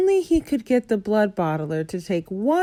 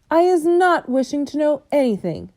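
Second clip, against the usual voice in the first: higher, dignified refusal; 7 semitones higher, 3 dB louder than usual. A narrator's reading. BFG refusing the giant's boast, dignified. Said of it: "very dignified".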